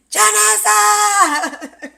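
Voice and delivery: high, loud, drawn-out call of a name; urgent call. Retold or imitated. Calling her roommate in alarm, high drawn-out shout. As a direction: shriek the friend's name for help. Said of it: "in the tone that she did".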